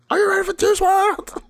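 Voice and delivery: very high, loud stage shout; mock hype man. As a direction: yell it like a DJ hyping a crowd. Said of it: "the D J or the hype man just goes".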